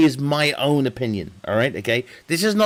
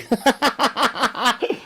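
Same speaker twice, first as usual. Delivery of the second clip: high, gleeful run of laughter; real laugh; 6 semitones higher than usual. Real, not acted. Streamer telling the Mars bar tale, laughing high. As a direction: crack up at your own punchline.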